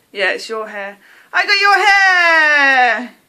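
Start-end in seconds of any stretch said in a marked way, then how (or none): 1.3-3.1 loud, drawn-out delighted shout; high, crowing cry of triumph; crazy excitement. Real, not acted. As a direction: shout it out and stretch the last word. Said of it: "I sound crazy".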